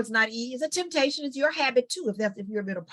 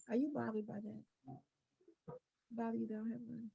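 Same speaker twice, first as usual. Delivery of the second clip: much softer, steady question turned back on them; calm, quiet comeback; 3 semitones higher, 13 dB quieter than usual. Shown as a demonstration. Daughter turning the insult back on the relative, softly. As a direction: ask it gently, almost amused. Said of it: "while you take the high road".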